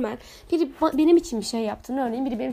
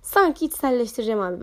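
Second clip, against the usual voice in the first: ordinary delivery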